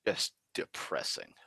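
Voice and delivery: dropped low and soft, deflated; a glum sigh of a line; low, deflated sadness. Real, not acted. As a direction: let it sink, truly downcast. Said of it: "What a depressing movie".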